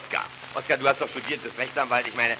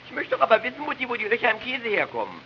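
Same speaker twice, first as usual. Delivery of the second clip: put-on whiny boy voice; 13 semitones higher than usual. Boy whining to mother about cheese holes. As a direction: whine the question like a small boy. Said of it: "weinerliche Jungenstimme".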